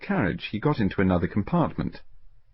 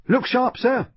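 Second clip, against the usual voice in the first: much higher, urgent call of a railway porter; hurrying porter; 3 dB louder than usual. Acted. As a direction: hurry him aboard. Railway porter hurries a passenger.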